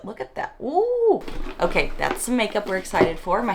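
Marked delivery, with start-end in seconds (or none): 0.0-0.5 awed whisper. Real, not acted. Whispered admiration. 0.6-1.2 high, delighted ooh. Real, not acted. Reacting to blush.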